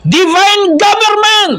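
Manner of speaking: very high, drawn-out slogan; rallying slogan cry. Shown as a demonstration. Preacher models the slogan to shout, drawn-out rallying cry. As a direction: stretch it out high like a rally chant. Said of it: "Yung dapat isigaw".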